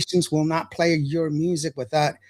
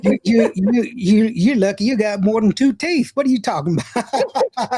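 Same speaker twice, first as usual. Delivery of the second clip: higher, louder country ribbing; country accent; 6 semitones higher, 4 dB louder than usual. Acted. Host acting out people doubting his degrees, country accent. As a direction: raise the voice into a hammed-up country accent.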